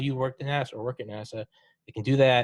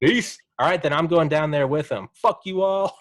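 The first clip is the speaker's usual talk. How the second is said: louder, playful sign-off; mock defiant farewell; 5 semitones higher, 6 dB louder than usual. Pretending to leave heaven's God behind, mock defiant. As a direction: toss it off like leaving a party.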